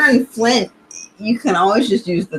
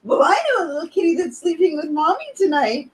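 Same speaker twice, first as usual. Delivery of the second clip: lightly voiced high fast cartoon mom; a hint of a teasing cartoon mother; South Park mom voice; 8 semitones higher, faster than usual. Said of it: "That was South Park".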